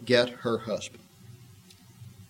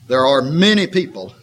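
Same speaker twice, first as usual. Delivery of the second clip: ordinary talk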